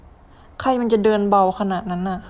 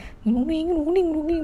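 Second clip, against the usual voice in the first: high mock hum of the monster's warning sound; imitated creepy hum; 8 semitones higher than usual. Gamer mimicking the only sound the monster makes, eerie hum. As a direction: hum it like the eerie game cue. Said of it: "มีแต่เสียงแบบ".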